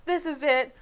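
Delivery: loud despairing moan. A narrator's reading. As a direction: wail it as if doomed. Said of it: "Ron moaned".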